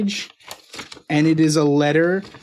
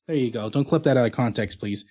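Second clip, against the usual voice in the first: usual speaking voice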